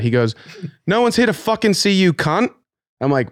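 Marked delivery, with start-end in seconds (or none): 0.9-2.5 high, loud-ish retold British jibe; put-on British accent, much higher; mock British accent. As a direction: imitate the DJ with a British accent, pitched way up. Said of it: "I just did British".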